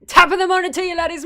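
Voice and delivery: loud, high mock-Scottish greeting; hammed-up Irish-turned-Scottish accent; Scottish-leaning accent. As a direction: belt out a Scottish-tinged intro. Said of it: "That was more Scottish".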